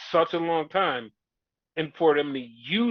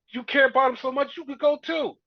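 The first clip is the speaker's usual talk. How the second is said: higher, mocking sneer at Jey; mock high Roman sneer; 11 semitones higher, 5 dB louder than usual. Roman sneering at Jey to leave too, high mock voice. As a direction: jeer in a raised mock voice. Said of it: "Roman's like".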